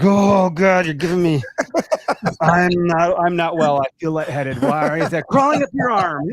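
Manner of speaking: loud, high squeamish panic; hammed-up horror at a spider; mock panic. Father recoiling from his son's spider, loud mock panic. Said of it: "I'm like".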